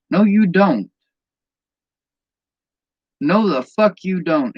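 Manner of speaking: flat-out refuse it, angry for real; angry retort. Real, not acted. Angry rebuttal of the blame.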